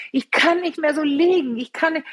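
mock moaning to the doctor; retold grumbling. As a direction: imitate your own fed-up griping. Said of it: "Und ich hab gemeckert".

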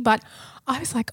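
ordinary talk

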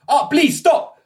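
high, loud panicked plea; alarmed foreigner crying out; startled pleading. Acted. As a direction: beg them not to shoot, voice way up. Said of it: "his eyes widen".

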